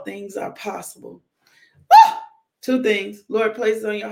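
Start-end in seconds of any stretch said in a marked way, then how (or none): 1.9-2.1 loud joyful whoop of praise; a real cheer at the sermon's high point; joyful woo. Real, not acted. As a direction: let out a celebratory woo. Excited praise shout in sermon.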